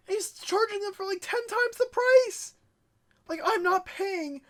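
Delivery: high-pitched, incredulous outburst; indignant, voice shooting up; outraged exclamation. Real, not acted. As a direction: exclaim at the sheer nerve. Outraged at a reseller's prices.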